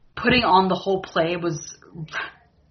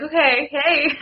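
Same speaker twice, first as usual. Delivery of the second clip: flirty mock greeting; 11 semitones higher, 4 dB louder, slower than usual. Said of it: "And she's like".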